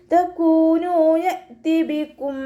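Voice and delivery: slow, drawn-out melodic tajweed chant; Quran recitation. Shown as a demonstration. Teacher models melodic Quran recitation for students. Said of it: "pronounce ചെയ്യേണ്ട ആവശ്യമില്ല".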